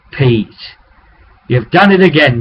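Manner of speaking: usual speaking voice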